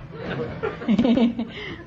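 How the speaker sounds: a high giggling laugh. Real, not acted. Contestant laughs when the host grills her, giggling. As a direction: giggle at the teasing.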